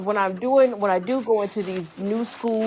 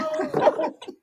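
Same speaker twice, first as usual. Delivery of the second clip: high laugh; 4 dB quieter than usual. Real, not acted. Laughing at own joke about coworkers, high laugh. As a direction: burst of high-pitched laughter.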